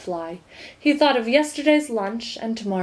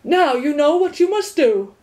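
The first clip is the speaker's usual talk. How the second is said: stern watchdog; 6 semitones higher, 5 dB louder than usual. A narrator's reading. Watchdog lecturing.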